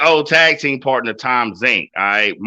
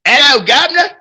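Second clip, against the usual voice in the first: a hammed-up British accent, much higher and louder; bad British accent; 13 semitones higher, 5 dB louder than usual. Shown as a demonstration. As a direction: chirp a cartoonish British greeting. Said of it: "what's my British?".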